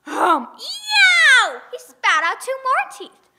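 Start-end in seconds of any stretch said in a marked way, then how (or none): 0.1-1.6 a hum then a high loud yowl; loud high yow of pain; pained yelp. Acted. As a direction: yelp as a tooth breaks. Giant bites again.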